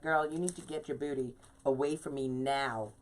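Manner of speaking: firm mother telling her puppy to back off; mommy voice. Retold or imitated. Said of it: "put my mommy voice on".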